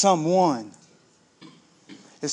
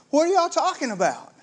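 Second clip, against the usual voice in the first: high, teasing feigned ignorance; playful innocence; 9 semitones higher than usual. Acted. Jesus teasing the two disciples on the road, high and playful. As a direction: play dumb with a laugh in it.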